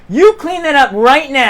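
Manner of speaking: loud, high-pitched command; furious big-sister telling-off; angry scolding. Acted. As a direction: scold a naughty child sharply. Grabs child by ear, scolding.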